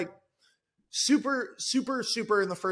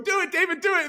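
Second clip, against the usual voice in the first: cheering on; 9 semitones higher, faster than usual. Retold or imitated. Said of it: "Jack's going".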